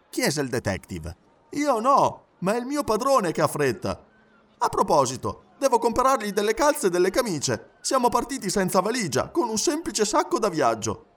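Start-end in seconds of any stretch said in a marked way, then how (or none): 1.5-11.0 high, eager valet's voice; animated high-pitched servant; talkative Passepartout, in a hurry. Acted. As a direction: chatter on in a lively high pitch. Passepartout chats to the detective.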